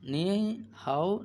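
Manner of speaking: "নি হাও", "নিন হাও" drawn out slowly, syllable by syllable; slow careful pronunciation drill; slow syllable demo. Shown as a demonstration. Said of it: "নিমেন হাও।".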